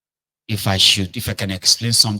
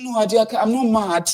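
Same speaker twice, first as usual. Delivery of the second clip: high, pleading protest; high frantic pleading; desperate denial; 14 semitones higher than usual. Retold or imitated. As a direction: insist you are not mad. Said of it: "He said".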